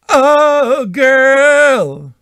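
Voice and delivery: high hammed-up diva coo; sassy girl voice. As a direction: gush it like a sassy best friend. Sassy compliment to patron.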